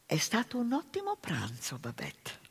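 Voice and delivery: whispered gratitude, barely voiced. Martina whispering thanks to Babette in the kitchen. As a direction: breathe it softly to the tired cook.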